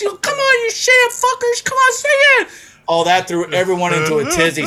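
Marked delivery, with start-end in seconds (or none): 0.0-2.5 imitating an enraged singer, very high; high, strained stage tirade; angry yelling back. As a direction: scream at the audience to join in.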